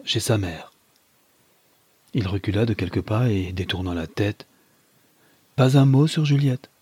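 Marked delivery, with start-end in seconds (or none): 5.6-6.7 raised, firm refusal; firm, higher-pitched command. Acted. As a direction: forbid it firmly, pitched higher. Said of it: "dit-il avec fermeté".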